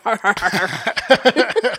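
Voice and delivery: hammy fake "har har" laugh. Shown as a demonstration. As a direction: chuckle out of the side of the mouth. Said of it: "Can you do the fake laugh right now?".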